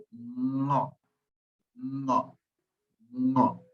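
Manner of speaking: "No" repeated, soft and nasal; syllable demo. Shown as a demonstration. Teacher showing the first syllable of ngäbe. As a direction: model the A with diéresis syllable. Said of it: "cómo sería".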